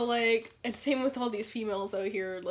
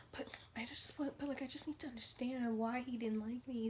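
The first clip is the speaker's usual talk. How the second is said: soft, halting mock plea; imitating upset friend; 9 dB quieter than usual. Friend puzzling over why he didn't like her, soft and halting. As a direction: stammer it quietly, as the friend.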